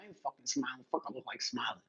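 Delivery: a lightly voiced grumpy brother behind the counter; sullen brother. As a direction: growl it down, refusing to smile. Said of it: "He's like".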